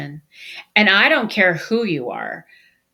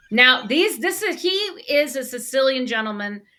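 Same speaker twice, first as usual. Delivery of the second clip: ordinary talk, nothing marked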